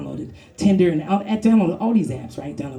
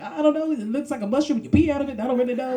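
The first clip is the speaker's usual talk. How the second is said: higher, lightly voiced bewildered musing; puzzled; 7 semitones higher than usual. Comic puzzling over it, high mock confusion. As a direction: wonder at it, confused. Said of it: "I was like".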